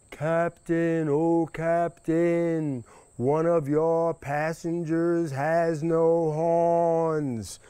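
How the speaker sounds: high, slow calling across the water; drawn-out call. Acted. Rabbit hailing the captain from the hill, slow and high. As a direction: stretch it out like hailing a far boat.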